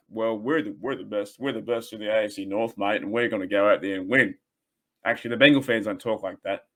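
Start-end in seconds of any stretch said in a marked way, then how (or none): 0.1-4.4 mocking Bengals fan. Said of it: "they are like".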